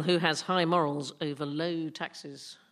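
ordinary delivery

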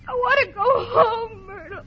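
slow, wailing, homesick cry; tearful plea. Acted. Upset dancer begging her friend to leave, tearful wail. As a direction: plead tearfully, drawing the words out.

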